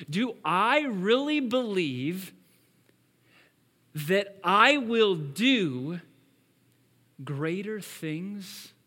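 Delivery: slow, loud stress on really; earnest self-question. Retold or imitated. Preacher asking himself about the verse, slow and emphatic. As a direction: lean hard into really, then slow. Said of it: "I come to that and I go".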